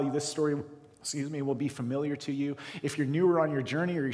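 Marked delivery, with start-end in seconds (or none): none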